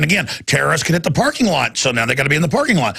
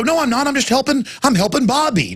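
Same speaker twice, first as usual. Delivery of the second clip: calm burglar; 9 semitones higher than usual. Retold or imitated. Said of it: "If you're just real calm and go".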